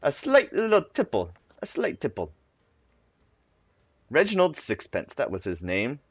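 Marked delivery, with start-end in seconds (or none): 0.0-1.3 mock British. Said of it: "I speak the British". 1.6-2.3 quiet mock British. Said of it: "I speak the British".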